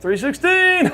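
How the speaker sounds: very high squeal with a laugh; gleeful cry. Retold or imitated. Paralyzed Lex greeting his visitor, gleefully crying out. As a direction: crow it out in a high mock cheer. Patient gleefully shouting room number.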